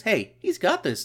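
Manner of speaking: normal talking voice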